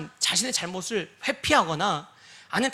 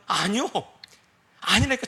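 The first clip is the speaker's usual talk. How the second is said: ordinary talk, nothing marked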